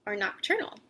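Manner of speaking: ordinary delivery, nothing marked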